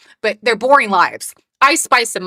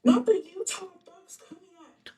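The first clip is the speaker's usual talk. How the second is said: mock dramatic; 8 semitones higher, 6 dB quieter than usual. Playful mock outrage, far voice.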